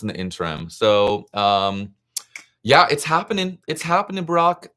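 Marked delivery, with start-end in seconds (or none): 2.6-4.7 excited. Real, not acted. Excited greeting to a viewer.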